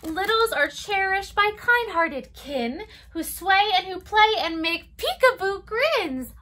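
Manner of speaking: lilting storybook read-aloud; circle-time story voice; reading to children. Acted. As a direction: read it gently to toddlers. Reads picture book to children.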